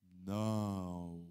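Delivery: a very low, soft, drawn-out "Não."; low, quiet "Não." of the ancients; deep solemn no. Shown as a demonstration. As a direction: sink "Não." into a deep, slow verdict. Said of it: "praticar a palavra dos antigos dizendo o quê".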